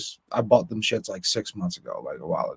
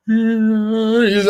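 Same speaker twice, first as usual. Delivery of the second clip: long, high mock wail; put-on crybaby moan; imitated fake cry; 8 semitones higher, 4 dB louder than usual. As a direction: draw out a phony whine. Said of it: "ugliest fucking fake cry face".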